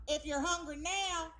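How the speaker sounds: imitated Southern commercial voiceover; simple Southern voice. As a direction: stretch it out like a homespun Southern ad. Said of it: "a simple Southern voice".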